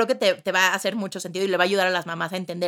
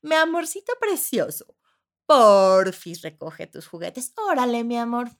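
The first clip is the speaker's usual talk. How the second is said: sugary sweet mom; 3 semitones higher, 5 dB louder, slower than usual. Retold or imitated. Mom asking child to pick up toys, sugary. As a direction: coax sweetly, no authority. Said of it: "ya es con tus hijos dices".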